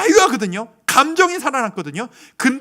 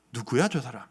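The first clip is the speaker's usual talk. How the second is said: fluister het, zonder stem; gefluisterd geroddel; 10 semitones lower, 9 dB quieter than usual. Retold or imitated. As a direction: zacht roddelend fluisteren in de menigte. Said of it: "사람들이 수군거리기 시작했을 거예요".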